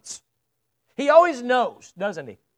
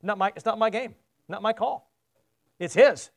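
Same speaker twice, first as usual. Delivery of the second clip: normal talking voice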